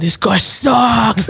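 drawn-out, high jeering shout; booing crowd. Retold or imitated. Retelling the Yankee Stadium boos, a long jeering shout. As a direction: heckle like a ballpark crowd, stretching it out. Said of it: "the amount of boos that came out".

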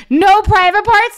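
high disbelieving echo rising at the end; can't believe he wrote that; incredulous. Real, not acted. Comedian echoes fiance's text in high disbelief. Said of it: "incredulous repeat of his text".

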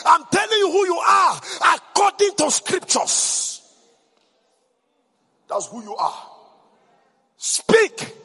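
loud rousing preacher declaring over music; full-force preaching. Acted. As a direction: proclaim it like thunder to the congregation.

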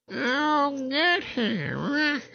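very high, drawn-out witch screech. Acted. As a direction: stretch it out like a cackling witch. Witch swooping at the heroes.

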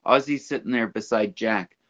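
ordinary talk

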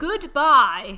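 very loud comic farewell; mock goodbye. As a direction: boom out a joking sign-off. Joking sign-off on not worth it.

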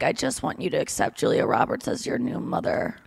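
low, soft dying-mom voice; dying mother. Retold or imitated. Acting Susan Sarandon's dying mom to the kids, low and weak. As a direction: quietly voice a mock deathbed plea. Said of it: "what's her name is dying".

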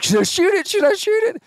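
very high, excited mock pleading; imitated eagerness. Imitates eager Bear asking to shoot.

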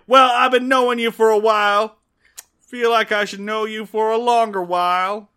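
loud, pushy demand; bullying proposal. Retold or imitated. Andy bullying Ellie into a proposal, loud and pushy. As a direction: push the proposal like an order. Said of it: "proposes through bullying".

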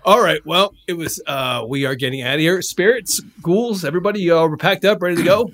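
high, loud roll call; rallying the group. Acted. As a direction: call everyone together to leave. Ghost rounds up the departing spirits.

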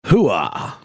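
gruff soldier's hooah; military hooah. Pretend. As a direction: bark it out like an army grunt. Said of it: "I just always feel compelled to chime in with hoo-ah".

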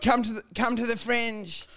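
high, strained mock yell; mock yell held back in volume; retold yell. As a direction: blurt it out as a panicked plug. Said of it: "I just yelled".

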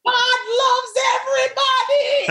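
hammed-up peacemaking mother's cry; mom calling out. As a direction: call it out high over a crowd. Mom calling into a knife fight.

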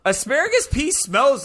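high, slow emphatic exclaim. Real, not acted. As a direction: stretch it out in disbelief. Emphatic disgust about smell.

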